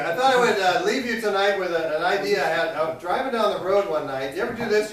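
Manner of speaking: ordinary talk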